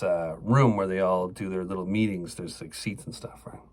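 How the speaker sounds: ordinary talk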